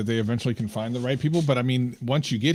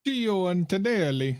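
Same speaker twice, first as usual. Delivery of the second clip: put-on name said in a high, slow Italian accent; 8 semitones higher, slower than usual. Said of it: "we can't help saying an Italian name in an Italian accent".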